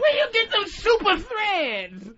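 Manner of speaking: high, slow comic wonder; impressed bystander. Acted. Bystander asking Superman about his costume, hammed-up amazement. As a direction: marvel and stretch the words out. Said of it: "impressed with somebody's costume".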